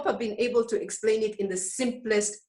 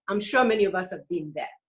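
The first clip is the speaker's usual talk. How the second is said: usual speaking voice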